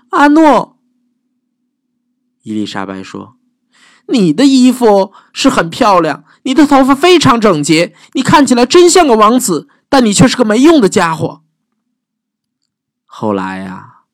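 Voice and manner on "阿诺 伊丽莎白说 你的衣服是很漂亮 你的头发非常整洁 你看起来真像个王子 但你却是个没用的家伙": high, brisk put-down; mock sweet then cut him down; princess telling him off.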